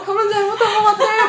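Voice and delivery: hammed-up loud sobbing wail; mock tearful lament; retold bawling. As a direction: cry it high and loud, imitating herself. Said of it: "막 엉엉 울면서".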